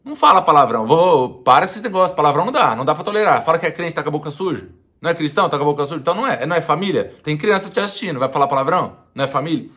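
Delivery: scolding church. Acted. Church telling the president to stop swearing, mock scolding. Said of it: "A igreja era pra falar".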